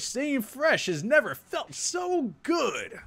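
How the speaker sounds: high, slow hyped commercial tagline; ad announcer voice. Acted. To viewers, like the end of a game ad, booming announcer style. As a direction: sell it like the big finish of a trailer. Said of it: "I should have music behind me when I say that".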